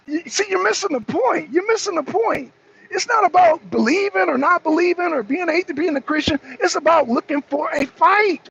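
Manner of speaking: high-pitched, exasperated insistence. Real, not acted. Frustrated at viewer missing the point.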